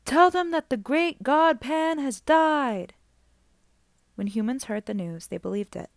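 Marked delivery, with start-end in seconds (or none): 0.1-2.9 high, slow cry from the shore; mysterious crying voice. Acted. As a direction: call it out slow and high like a distant voice. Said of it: "a mysterious voice crying out".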